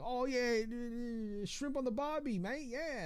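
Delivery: high, hammed-up Aussie accent; Aussie cliché put on in a high voice; mock Australian. Streamer mimics the Australian cliché in a high mock Aussie accent. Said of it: "the only thing I ever knew was like".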